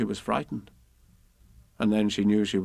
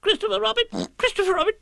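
very high squeaky call; crying out; 4 dB louder, faster than usual. A narrator's reading. Piglet appealing to Christopher Robin, squealed cry. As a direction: squeal the name urgently, twice. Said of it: "cried Piglet".

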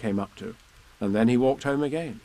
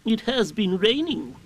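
high, slow owl explanation; explaining owl voice; 9 semitones higher, slower than usual. A narrator's reading. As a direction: explain slowly as a learned owl. Owl explains the long words.

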